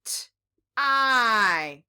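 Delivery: "T-I" as a lone t, a gap, then long i; sounding out. Shown as a demonstration. Teacher reading a short word with children, sounding out. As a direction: say each sound of "T-I" separately, holding the i. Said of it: "Can we read it?".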